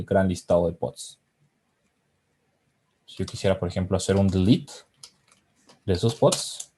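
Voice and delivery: ordinary talk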